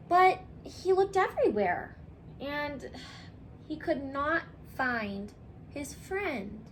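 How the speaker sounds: ordinary delivery, nothing marked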